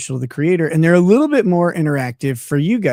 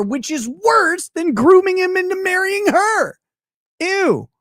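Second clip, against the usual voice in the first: high disgusted rant; 11 semitones higher than usual. Real, not acted. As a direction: sneer it out in disgust. Ranting disgust, much higher.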